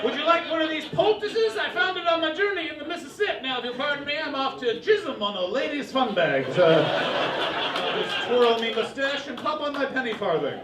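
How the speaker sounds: put-on high, loud old-time showman voice; 1920s huckster. The huckster offering poultices to his girlfriend, high and loud. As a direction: pitch it like a travelling snake-oil salesman. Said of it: "like a huckster from the nineteen twenties".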